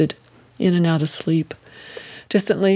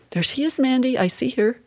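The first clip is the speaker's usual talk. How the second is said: high-pitched, eager announcement; excited encouragement; 7 semitones higher than usual. A narrator's reading. Mother to her daughter in labor, excited encouragement. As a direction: cheer her on, pitch lifted, without shouting. Mother sees the baby coming.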